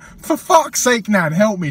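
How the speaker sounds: loud, high exasperated plea; retold shout at a dithering nan. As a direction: drag it out, fed up and loud.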